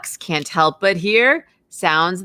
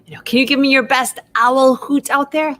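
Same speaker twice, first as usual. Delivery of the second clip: usual speaking voice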